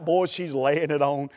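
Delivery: usual speaking voice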